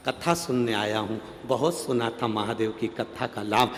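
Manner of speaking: normal talking voice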